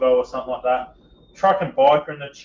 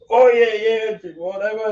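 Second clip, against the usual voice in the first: casual shout into the mic; loud offhand yell while riding; mock shout; 8 semitones higher, 6 dB louder than usual. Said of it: "just shouting kinda".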